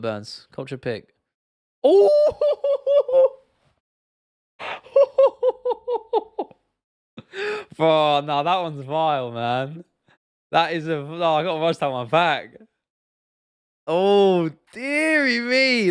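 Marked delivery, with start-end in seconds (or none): 1.8-6.4 high, loud hooting laugh; long whooping "ooh" breaking into staccato hoots; shocked hooting. Real, not acted. Reacting to a vile clip. 13.9-15.9 high, loud, slow "oh dearie me"; long, rising-pitched wail of disbelief; appalled exclamation. Real, not acted. Appalled reaction to vile clip.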